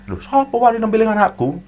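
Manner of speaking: angry Madurese father, high and loud; Madurese accent. Retold or imitated. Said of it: "dengan logat yang". (logat Madura marah, tinggi dan keras)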